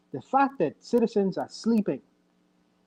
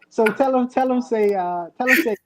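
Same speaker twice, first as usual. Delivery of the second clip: put-on African accent; 6 semitones higher, 6 dB louder than usual. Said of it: "in an African accent?".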